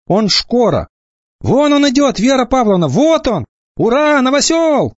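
put-on kids shout as he appears; excited kids. (высокие, громкие детские выкрики)

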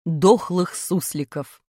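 title read. A narrator's reading.

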